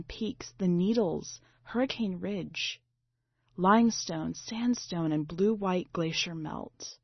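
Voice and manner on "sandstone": usual speaking voice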